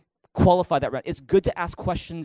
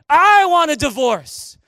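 high, loud hammed-up outburst; mock relationship-ending shouts; acted threats; 11 semitones higher, 7 dB louder than usual. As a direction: yell dramatic ultimatums, stretching the opening words. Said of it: "Those are threats".

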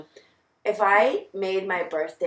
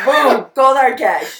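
loud triumphant catchphrase; excited shout; 6 semitones higher, 11 dB louder, slower than usual. Acted. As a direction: shout it like a jingle. Hyped catchphrase reveal.

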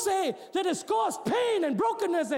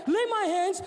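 ordinary talk, nothing marked

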